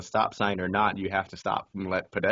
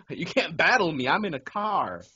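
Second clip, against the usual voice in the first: higher-pitched incredulous protest; mock indignation; 7 semitones higher than usual. Driver scoffing at a pedestrian, mock indignant. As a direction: argue back with comic outrage.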